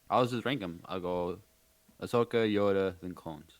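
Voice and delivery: ordinary talk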